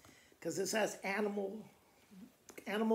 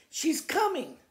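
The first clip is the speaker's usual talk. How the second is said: excited farmer; 7 semitones higher, 6 dB louder than usual. Acted. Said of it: "the farmer said to the owl".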